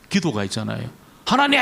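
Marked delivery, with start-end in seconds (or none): 1.3-1.6 high, loud mock demand to God; imitated commanding prayer. Seminary student praying aloud before class, imitated commanding cry. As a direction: call out as if ordering God about. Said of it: "막 명령하는 거예요".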